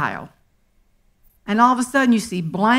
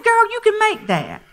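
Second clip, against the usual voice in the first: sassy encouragement; 12 semitones higher than usual. Retold or imitated. Advising a fretting girlfriend.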